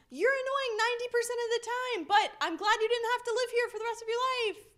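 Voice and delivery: a hint of a grudging brother; teasing siblings. Siblings teasing their found sister, grudgingly glad. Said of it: "my siblings were like".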